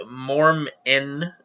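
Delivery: ordinary talk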